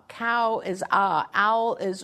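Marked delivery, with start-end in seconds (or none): 0.9-1.3 AH said for cow, just an open vowel; child's mispronunciation. Retold or imitated. Host reading out how a boy says cow, reduced to AH. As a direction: reduce cow to a lone AH, no opening K. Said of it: "Like, he will say".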